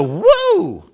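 high, swooping woo; amazed woo. Acted. God predicts the listener's amazement, a high startled woo. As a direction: reel back with an amazed woo. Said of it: "step back and go".

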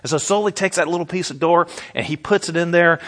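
ordinary talk

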